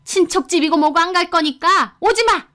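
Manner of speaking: high, angry outburst on the phone; furious mother-in-law. A narrator's reading. Mother-in-law tells couple to turn the car back, high furious command. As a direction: order them away in a high, heated voice. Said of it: "어머님은 화를 계속 내시더니".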